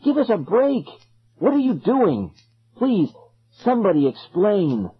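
high, exasperated outburst; frantic pleading. A narrator's reading. Trapped kid to circle, frantic high plea. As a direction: cry out desperately, without full shouting. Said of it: "I screamed".

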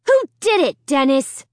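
high, loud angry demand. Acted. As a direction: shout the demand at him. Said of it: "I demanded".

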